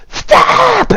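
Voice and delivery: high-pitched screamed command. A narrator's reading. Anzi launching a lightning spell, high scream. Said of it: "And she will scream".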